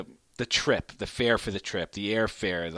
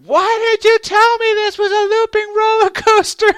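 high screaming shout, much louder; screaming child; 13 dB louder than usual. Retold or imitated. Terrified son screaming at his dad on the loop, high loud scream. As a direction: scream it in panic like a terrified kid. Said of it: "starts screaming".

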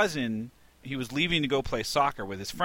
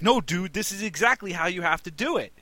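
higher, louder scolding at a worker; retold yelling; 7 semitones higher, 4 dB louder than usual. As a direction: yell at him like he's defying you. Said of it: "I'm yelling at him now".

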